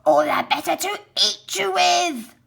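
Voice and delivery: loud, hissed menace; growling wolf. Acted. Wolf revealing himself to the girl, a loud menacing whisper. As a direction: drop the disguise and threaten her. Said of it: "growled the wolf".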